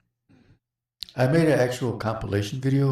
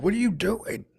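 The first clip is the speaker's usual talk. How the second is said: higher-pitched mock disbelief; startled retold question; 9 semitones higher than usual. Host retelling his reaction as she gets up, mock startled. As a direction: act out a startled reaction, voice jumping up.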